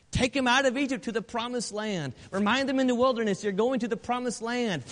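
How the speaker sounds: loud, high, ringing preacher's cry; full-force preaching. Acted. Preacher rallying brethren against Satan, loud, high full-force preaching.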